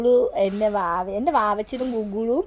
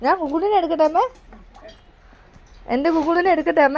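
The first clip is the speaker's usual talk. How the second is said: pleading; 5 semitones higher than usual. Real, not acted. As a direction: beg again, pitch up and push on അമ്മേ. Asks mother again and again. (ഉയർന്ന ശബ്ദത്തിൽ കെഞ്ചൽ)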